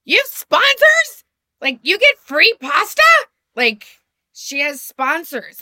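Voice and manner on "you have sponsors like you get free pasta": loud high incredulous squeak. Retold or imitated. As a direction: squeak it out in mock shock. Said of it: "I'm like".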